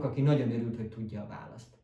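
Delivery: plain reading voice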